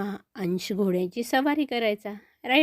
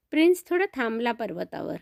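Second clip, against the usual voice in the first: plain reading voice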